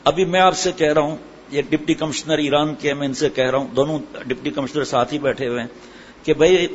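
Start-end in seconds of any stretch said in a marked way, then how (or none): none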